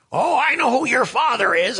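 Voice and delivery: put-on high exclaiming old lady; mock old lady exclaiming. Imitating ninety-two-year-old lady.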